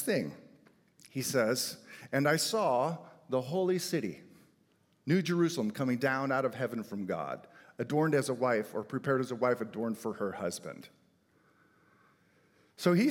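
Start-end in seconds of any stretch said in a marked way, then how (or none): 2.1-10.9 raised, ringing Bible reading; scripture reading. Acted. Pastor reading Revelation aloud, ringing. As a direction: proclaim the verse to the room.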